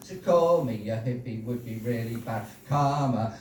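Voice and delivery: level, chant-like rhyming patter, creeping up in pitch; rhythmic poem recital. Acted. Poet performing a birthday poem for the audience, incantatory rhymed recital. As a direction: keep a steady beat and lean on each rhyme.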